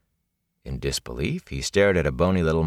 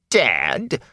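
sharp offended question; indignant snap; 5 semitones higher than usual. A narrator's reading. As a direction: snap back, insulted. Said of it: "the peddler snapped indignantly".

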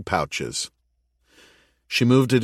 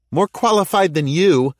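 high, loud retort over the radio; calling back; 10 semitones higher, 5 dB louder than usual. Acted. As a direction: fire it back playfully. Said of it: "Callie called back".

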